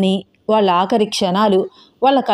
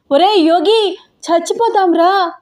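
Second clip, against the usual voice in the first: high, contained narrated scream; 9 semitones higher than usual. A narrator's reading. Gauri screams at the driver, panicked high cry. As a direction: cry out in panic, pitched well up. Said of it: "గొంతు చింపుకుని అరుస్తోంది".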